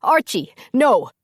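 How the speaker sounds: high, loud pleading cry; trying to stop his brother; desperate no; anguished shout. A narrator's reading. Angus seeing brother charge.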